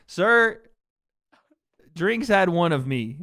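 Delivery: loud, hammed-up drunk protest; mock drunk driver. Drunk driver answering a cop, loud hammed-up protest. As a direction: blurt it out loudly as a muddled mock drunk.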